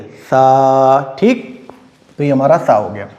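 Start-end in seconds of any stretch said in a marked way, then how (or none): 0.0-1.2 सा with the tongue against the front teeth. Shown as a demonstration. Teacher demonstrating correct सा, tongue on teeth. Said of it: "ज़बान आगे के दांतों से लगती है".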